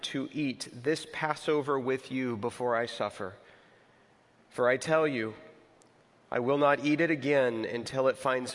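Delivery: reading the Passover scripture to the congregation, measured. Acted. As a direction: read the Gospel aloud, unhurried. Scripture reading at sermon start.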